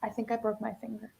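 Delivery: softly, very calmly; quiet, calm understatement. Retold or imitated. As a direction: deliver the bad news softly and evenly. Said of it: "I very calmly said".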